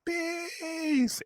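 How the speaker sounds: very high, drawn-out falsetto cry; mock yell. Trainee yelling as told while running from bees, mock yell. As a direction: imitate a yell without the volume. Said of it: "You gotta yell bees".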